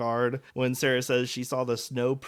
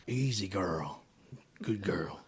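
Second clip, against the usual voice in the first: low, hushed cowboy murmur; rugged cowboy accent; 8 semitones lower, 8 dB quieter, much slower than usual. Acted. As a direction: soothe a horse like a rugged cowboy. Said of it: "with a real rugged cowboy sort of accent".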